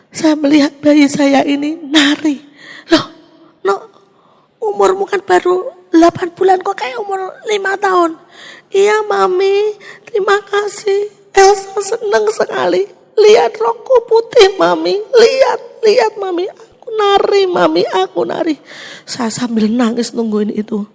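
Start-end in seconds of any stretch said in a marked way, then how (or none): 2.9-8.2 tearful. Retold or imitated. Mother sees dead baby dancing. 8.7-18.6 put-on high, childlike, slow and tearful; a little girl happily showing her mother she dances; voiced little daughter. Mother voices her dead baby.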